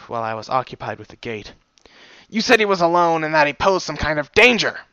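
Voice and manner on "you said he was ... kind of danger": sharp retort. Acted. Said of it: "the stallion retorted".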